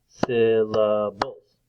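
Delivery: "syllables" very slowly, beat by beat; split into syllables. Shown as a demonstration. Teacher clapping out the parts for children, "syllables" slowly split. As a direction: stretch out each part of "syllables". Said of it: "clap out the different parts".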